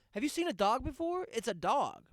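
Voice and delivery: exasperated rant. Real, not acted. Ranting at fake dog lovers.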